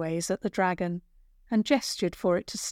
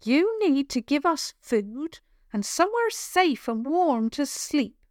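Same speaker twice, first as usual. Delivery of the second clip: put-on brave young girl; 6 semitones higher than usual. Girl bargaining with troll.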